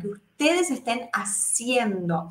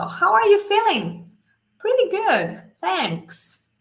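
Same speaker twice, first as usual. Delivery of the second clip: high, lively friendly greeting; teacher voicing a quick English exchange; acted dialogue; 9 semitones higher, slower than usual. Shown as a demonstration. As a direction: play both friends chatting brightly.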